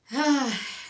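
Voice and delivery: long loud weary sigh; exhausted sigh at a rough week; heavy sigh. Real, not acted. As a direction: let out a big tired breath.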